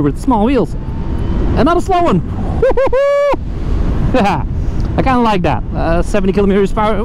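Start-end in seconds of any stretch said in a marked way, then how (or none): 2.2-4.9 high giggle. Real, not acted. Delighted by scooter speed.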